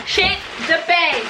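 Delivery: high, slow shocked exclamation; stunned squeal. Real, not acted. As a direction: gasp it out in disbelief.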